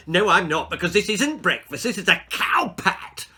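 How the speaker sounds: a hint of a cheeky, smirking boy. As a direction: smirk through the insult. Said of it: "smirked Timothy".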